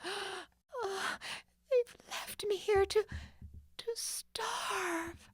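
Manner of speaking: high slow breathy panic; frantic. Acted. Locked in, Nancy realizes she'll starve, frantic whisper. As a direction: whisper in dawning terror. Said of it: "she thought frantically".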